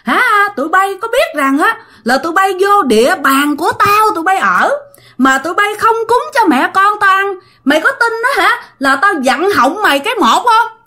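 high, fast furious scolding; high, harsh threatening tirade from the ghost; ghost cursing. Retold or imitated. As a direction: curse them out like a vengeful ghost. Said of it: "Cổ chửi".

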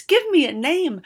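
high, loud desperate plea; girl begging the wizard for a name; pleading. A narrator's reading. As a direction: beg for it. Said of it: "the girl pleaded".

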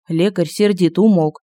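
ordinary delivery

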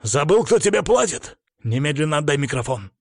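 narrated character losing his cool; losing self-control. A narrator's reading. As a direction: snap out an indignant order. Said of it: "теряя самообладание".

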